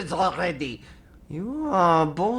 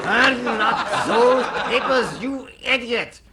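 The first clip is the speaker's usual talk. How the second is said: exasperated scolding; 6 semitones higher, 3 dB louder than usual. Acted. Major scolds a bumbling prisoner, exasperated. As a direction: scold the fool in mock German.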